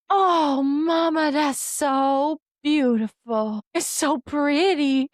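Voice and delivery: high, loud, slow wonder; awestruck toddler. Retold or imitated. Mom voicing toddler amazed by Halloween decorations, high slow awe. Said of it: "And she goes".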